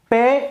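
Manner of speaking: हिंदी वाला पे बोलो, पी नहीं; Hindi pe sound. Shown as a demonstration. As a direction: say पे as a short Hindi pe, not the English letter P. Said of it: "मैं पी और टी नहीं बोल रहा".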